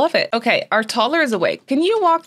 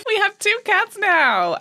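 squealing; 10 semitones higher than usual. Real, not acted. Thrilled about cats.

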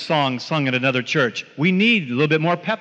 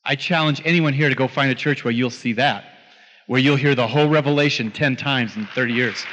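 ordinary delivery

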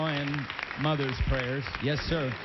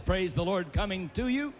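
usual speaking voice

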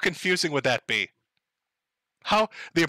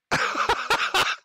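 high giggle. Real, not acted. Laughs at own joke.

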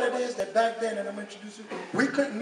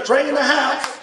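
hype call; 5 semitones higher, 7 dB louder than usual. Real, not acted. Hyping up the next comic.

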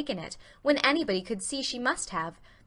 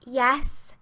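admitting; 4 semitones higher, 4 dB louder than usual. A narrator's reading. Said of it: "admitted Anne".

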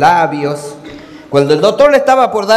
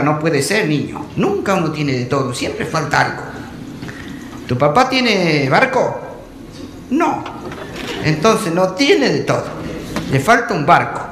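teacher voice; 5 semitones lower, 5 dB quieter than usual. Acted. Teacher corrects boy.

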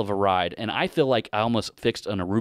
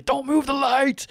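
higher, slow, strained command; mock strained order; 3 dB louder, slower than usual. Telling his son not to move the flashlight, mock-strained. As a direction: stretch the order out in a put-on voice.

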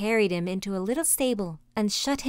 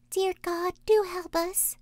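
put-on high, pleading little-girl voice; Gretel crying out; 10 semitones higher, slower than usual. A narrator's reading. Poor little sister laments and begs God, high crying plea. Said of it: "she cried".

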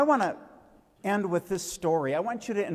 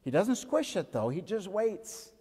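put-on lively storyteller voice, a little higher and bright for the kids; story for children; 5 semitones higher than usual. Tells children a bee story.

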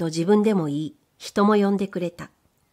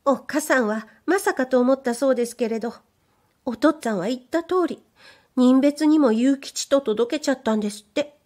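higher, bashful maid's voice; shy young maid; 5 semitones higher than usual. Acted. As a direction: play the timid young servant girl. Maid continues.

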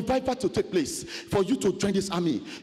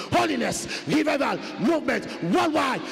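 preaching shout; 5 semitones higher than usual. Acted. Preacher proclaiming revival to his congregation, shouting. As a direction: hurl each word at the church.

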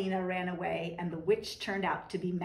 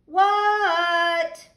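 calling back; 8 semitones higher, 9 dB louder than usual. Acted. Froggy answers mother's call.

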